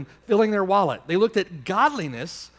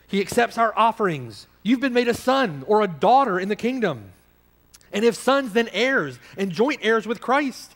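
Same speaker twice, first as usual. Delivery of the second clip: preaching at full force; 4 semitones higher, 3 dB louder than usual. Real, not acted. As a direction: pour it out over the congregation, building.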